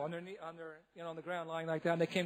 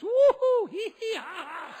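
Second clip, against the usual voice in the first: much higher, louder woo-hoo then slow giggle; drunken hee-hee laugh; giddy whoop; 9 dB louder, slower than usual. Retold or imitated. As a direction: whoop and cackle like a tipsy priest. Imitating tipsy priest on stairs.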